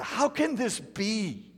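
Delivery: high, slow bewildered lament; despairing question. Pretend. Preacher voicing someone without words, slow despairing. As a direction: ask it as if the world broke.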